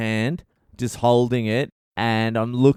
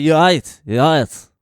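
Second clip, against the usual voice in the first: loud, higher imitation of a thick Eastern European accent; thick foreign accent; 5 semitones higher, 9 dB louder than usual. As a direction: bark it in a mock Russian-type accent. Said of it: "he's got such a thick accent".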